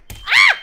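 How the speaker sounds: sudden high shriek; startled scream. Real, not acted. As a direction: shriek out in fright. Sudden scare.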